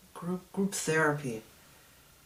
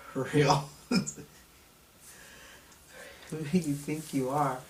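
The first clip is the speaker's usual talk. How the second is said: normal talking voice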